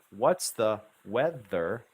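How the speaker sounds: "What's the weather" sounded out slowly, syllable by syllable; word-by-word. Shown as a demonstration. Teacher working through a dialogue for learners, word-by-word. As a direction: say "weather" in two clear, drawn-out syllables. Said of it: "Let's work through it".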